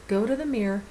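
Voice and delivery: ordinary talk, nothing marked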